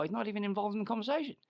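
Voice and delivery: ordinary talk, nothing marked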